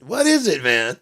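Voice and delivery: high, loud mock exasperation; exasperated demand. Baffled by an unpronounceable name, exasperated shout. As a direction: demand it at the top of your voice.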